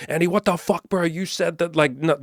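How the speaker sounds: hammed-up freakout blaming the video guy; frantic panic. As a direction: freak out in a shrill rush of panic. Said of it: "You're like".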